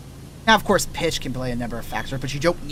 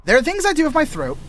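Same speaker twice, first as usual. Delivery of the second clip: high-pitched voice; 12 semitones higher, 5 dB louder than usual. Shown as a demonstration. Jumps into high voice.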